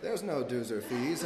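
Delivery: slow, measured hypnotic suggestion; Jedi mind trick. Retold or imitated. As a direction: plant the idea slowly, calmly. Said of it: "Jedi mind trick where they're like".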